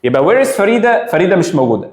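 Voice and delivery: usual speaking voice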